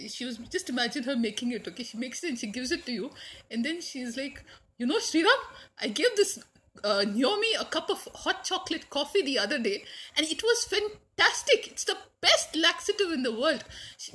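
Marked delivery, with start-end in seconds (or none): 4.8-11.7 high, loud gushing auntie; Joy Auntie. Retold or imitated. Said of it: "Joy Auntie's voice". 11.7-13.6 high, loud gushing auntie; Joy Auntie. Retold or imitated. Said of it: "Joy Auntie's voice".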